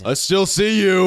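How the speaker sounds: loud, high yell; angry shout. Acted. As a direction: bellow across the yard. Owner yelling at hiding intruders.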